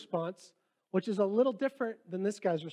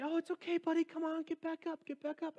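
tender encouragement; 12 semitones higher than usual. Pretend. Dad gently encouraging his fallen son to get up. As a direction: coo it like to a toddler. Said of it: "no, you say".

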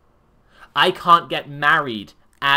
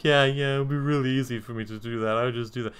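quick, offhand mock agreement; nonchalant, flippant; faster than usual. Acting out a listener who won't genuinely imagine it, flippantly nonchalant. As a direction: breeze through it as if nodding along without thinking. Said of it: "just sort of nonchalantly, flippantly, like, nod along".

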